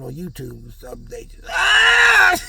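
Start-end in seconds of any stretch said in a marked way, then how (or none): 1.5-2.4 sudden loud yelp. Pretend. Acting angry person laughing at TV.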